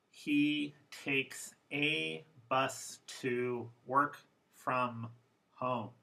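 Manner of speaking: slow, careful formal English, every word full. Shown as a demonstration. Teacher reading a sentence slowly in formal English. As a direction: read it slowly and fully, no reductions. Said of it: "First, formal".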